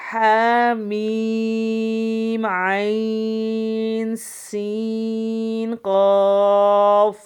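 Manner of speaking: very slow loud drawn chanting of the letters; model recitation. Shown as a demonstration. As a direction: stretch every مد long and full. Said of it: "یہ میں نے دوبارہ پڑھ کے بتایا ہے".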